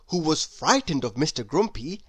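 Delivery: plain reading voice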